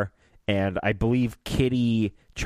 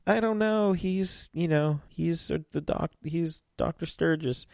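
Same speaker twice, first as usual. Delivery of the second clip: Isabel; 7 semitones higher, 3 dB quieter than usual. Retold or imitated. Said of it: "And she's like".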